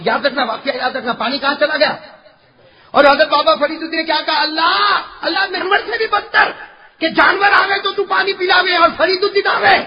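high, loud, climbing to a shout; impassioned preaching. Acted. Preacher recalling the saint's complaint to Allah, impassioned. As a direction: drive the retelling up to a cry.

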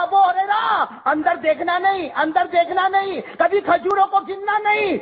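high, firm preached instruction; a lightly voiced earnest command.

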